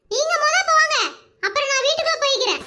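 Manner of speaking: put-on high child's voice, brushing off flattery; be a cheeky small girl demanding answers; little granddaughter. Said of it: "அவளோட பேத்தி". (சின்னப் பிள்ளையின் கறார் குரல்)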